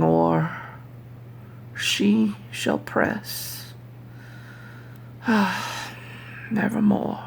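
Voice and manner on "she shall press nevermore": slow, breathless whisper with a sigh; hushed whisper. Acted. Grieving narrator whispering of lost Lenore. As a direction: let it fade into a sigh and a whisper.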